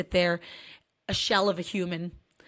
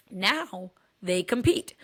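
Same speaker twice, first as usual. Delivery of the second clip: ordinary talk, nothing marked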